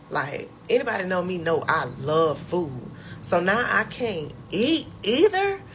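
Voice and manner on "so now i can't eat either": high, drawn-out incredulous complaint; retold indignant protest; upset disbelief. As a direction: stretch it out, voice climbing in dismay. Reacting upset to feeding tube news.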